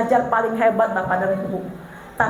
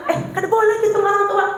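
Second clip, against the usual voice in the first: scolding parent; 7 semitones higher, faster than usual. Retold or imitated. Scolding a child for rudeness.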